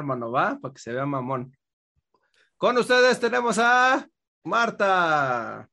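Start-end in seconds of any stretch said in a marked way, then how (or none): 2.6-5.7 drawn-out, higher show-host introduction; stretched-out ta-da announcement; triumphal introduction. Pretend. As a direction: announce the guest with a grand, slow build. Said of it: "Gracias por la entrada triunfal".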